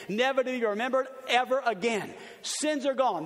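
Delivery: ordinary talk, nothing marked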